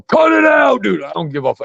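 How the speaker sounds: put-on sudden high shout at the kids; yelling at the kids. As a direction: bark it at them like a fed-up dad. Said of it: "I'm like".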